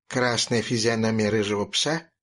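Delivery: ordinary delivery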